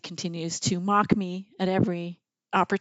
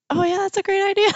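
high, loud and rushed; mock-frantic agreement; 11 semitones higher, 5 dB louder, faster than usual. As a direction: blurt it in a squeaky rush. Panicked mother answers her daughter.

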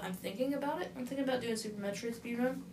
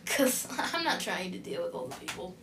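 ordinary talk, nothing marked

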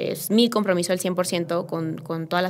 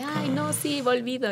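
high, softer mimicry of teasing friends; playful mock ribbing, pitched up; friendly teasing; 6 semitones higher, 5 dB quieter, slower than usual. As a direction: imitate friends poking gentle fun. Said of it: "amigos haciéndome bullying".

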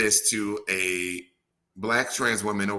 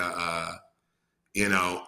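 normal talking voice

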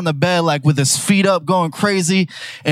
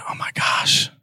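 inner thought whisper; 5 dB quieter than usual. Retold or imitated. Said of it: "I was thinking".

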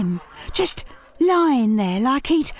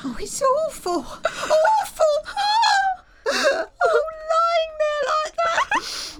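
high, broken weeping over a body; sobbing wail. Acted. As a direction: wail it out through tears. Leah wailing over a body.

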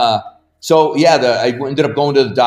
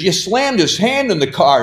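higher-pitched, mildly exasperated retort; retorting dad; 8 semitones higher than usual. Retold or imitated. Dad to mom about the hand, imitated exasperated retort. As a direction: imitate a father pointing out the obvious.

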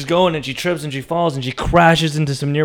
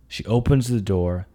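usual speaking voice

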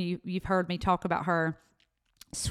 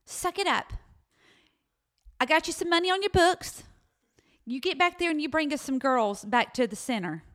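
higher, firm no-nonsense mentor voice; stern Miss Hunsinger; 8 semitones higher than usual. Retold or imitated. Miss Hunsinger ordering her to toughen up, stern and high. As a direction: tough love, brisk and commanding.

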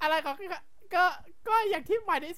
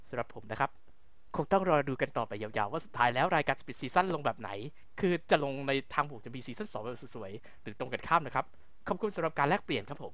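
very low, hushed pastel politeness; pastel answer; 11 semitones lower, 5 dB quieter than usual. Pretend. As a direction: put on a soft, diplomatic low voice. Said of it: "ขออนุญาตตอบแบบพาสเทลนะ".

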